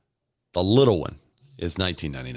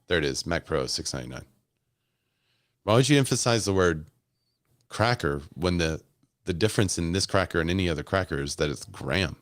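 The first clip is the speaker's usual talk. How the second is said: ordinary delivery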